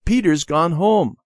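put-on high, loud child's call. A narrator's reading. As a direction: call the news through the house. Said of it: "he called".

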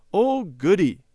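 put-on girl thrilled about a party invitation; excited cry. A narrator's reading. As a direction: cry out with glee. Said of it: "cried Debbie".

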